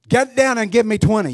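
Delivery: loud, high barked order; drill sergeant. Retold or imitated. Preacher playing a boot sergeant barking at a recruit, loud and high. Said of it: "your boot sergeant says".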